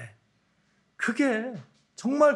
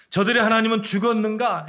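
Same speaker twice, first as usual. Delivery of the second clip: scornful taunt with a heavy falling end; mocking question; 5 semitones higher, 5 dB louder than usual. Nations jeering at Israel, scornful and louder. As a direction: sneer it at the captives. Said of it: "이렇게 조롱했습니다".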